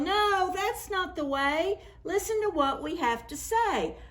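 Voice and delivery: put-on high children's yell. A narrator's reading. The children warn Sneezy not to undress, high. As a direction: call out like worried kids. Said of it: "The children yelled".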